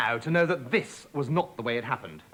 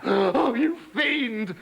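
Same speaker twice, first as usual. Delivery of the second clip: gasping frog; 5 semitones higher, much slower than usual. Acted. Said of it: "gasped Pipsqueak".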